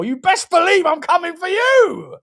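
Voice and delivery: loud, high gloating taunt; mock threat. Streamer taunting rival fans, loud gloating threat.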